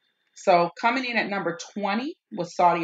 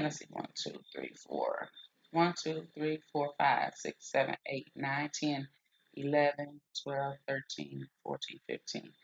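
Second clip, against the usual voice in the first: counting under breath; 3 semitones lower, 10 dB quieter than usual. Real, not acted.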